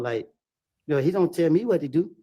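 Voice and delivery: ordinary delivery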